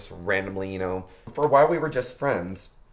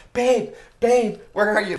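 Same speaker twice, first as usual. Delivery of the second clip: loud, high-pitched frantic call; retold calling out; 12 semitones higher, 8 dB louder than usual. Partner comes home searching for their babe, calling out loudly. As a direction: imitate someone urgently calling for their babe.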